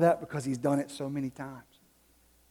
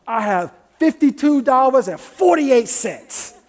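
put-on slow, loud, higher, beaming kid's boast; a kid showing off his money; proud kid; 7 semitones higher, 12 dB louder, slower than usual. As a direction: announce your savings like a proud little boy, grinning. Dad voices son counting money.

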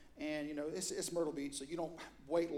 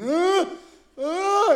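high, loud panicked uh-uh; mock terrified stutter; panicked stammer; 13 semitones higher, 18 dB louder than usual. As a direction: gasp out a scared stammer. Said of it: "he started going".

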